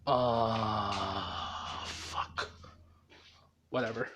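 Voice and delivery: low, soft, drawn-out pondering; muttering to himself. Retold or imitated. Izuku unsure which direction to go, muttering low. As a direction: mumble it low, then give up. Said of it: "he's like".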